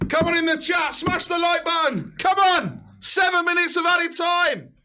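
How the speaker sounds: high, loud hype for the viewers; hyped shout. Real, not acted. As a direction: pump up the chat at full volume. Hyping the chat in stoppage time.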